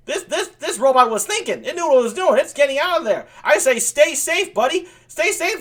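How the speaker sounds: high-pitched, worked-up rant; root urgently for the runaway underdog; indignant cheering-on. Real, not acted. Host urging runaway robot to stay safe, indignant.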